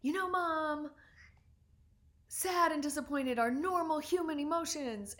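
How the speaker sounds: mock yell of a fed-up teenage daughter; retold teen yell. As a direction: throw it back at mom like a lecturing teenager. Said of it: "and she was yelling".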